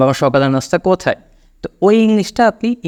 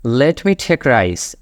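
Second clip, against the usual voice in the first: slow model sentence; much slower than usual. Shown as a demonstration. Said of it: "আরেকটা line".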